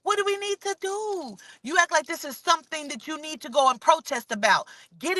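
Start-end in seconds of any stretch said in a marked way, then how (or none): 0.0-1.4 mock helpless public. Mimicking everybody still asking what to do, mock-helpless. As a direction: imitate everyone pleading, pitched up. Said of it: "Everybody is still talking about".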